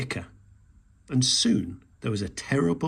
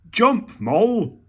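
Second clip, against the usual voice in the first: a loud, slow, higher shout up the tree; 9 semitones higher, 8 dB louder, slower than usual. A narrator's reading. Badger to Mole in the tree, loud shout. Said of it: "shouted Badger".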